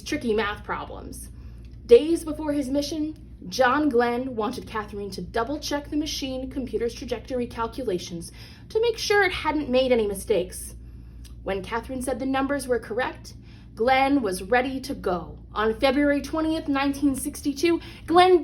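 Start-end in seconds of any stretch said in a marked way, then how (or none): none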